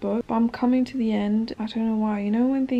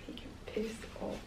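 "He can piss off" whispered much softer; whispered repeat; 14 dB quieter than usual. Real, not acted. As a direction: hiss it again under your breath.

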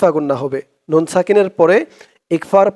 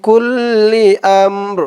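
slow melodic tilawat chant; Quran recitation; 3 semitones higher, much slower than usual. Shown as a demonstration. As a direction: draw out each word in recitation tune. (ধীর সুরেলা কুরআন তিলাওয়াত)

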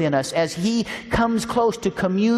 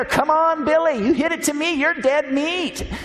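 high, raised playful taunt, slowing on the last words; mock taunting shout; 9 semitones higher than usual. Old lady taunting the batter, high playful shout. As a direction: dare the batter with glee. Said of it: "she'd shout at the batter".

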